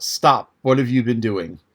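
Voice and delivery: plain reading voice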